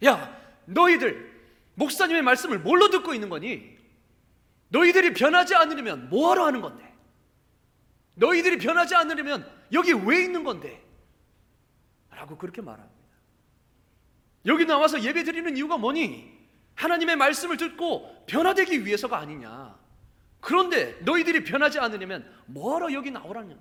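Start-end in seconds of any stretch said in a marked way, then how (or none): 0.1-10.9 loud, high confrontational outcry; outraged shouting. Retold or imitated. New believer jumps up to rebuke church kids, outraged shout. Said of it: "자리를 박차고 일어나서 이렇게 외칩니다". 14.5-23.6 raised, forceful rebuke in a retelling; indignant shouting. Retold or imitated. New believer challenges church kids on why they worship, indignant shout. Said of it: "이렇게 외칩니다".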